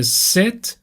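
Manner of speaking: sit with a short lax "i" and relaxed lips. Shown as a demonstration. Teacher showing students a lax vowel, short sit. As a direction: say sit with loose lips, not stretched like seat.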